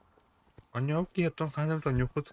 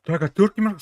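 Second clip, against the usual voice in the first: grenade warning yell; 7 semitones higher, 9 dB louder than usual. Real, not acted. Grenade thrown nearby in firefight.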